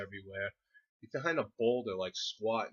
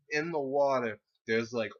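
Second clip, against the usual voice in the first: ordinary talk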